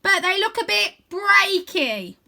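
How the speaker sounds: put-on high, slow and loud goofy boy; calling down in a big high cartoon voice; bright playful wizard-boy voice; Wyn's voice. A narrator's reading. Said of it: "he continues".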